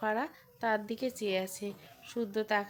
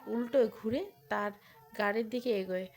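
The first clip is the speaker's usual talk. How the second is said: ordinary delivery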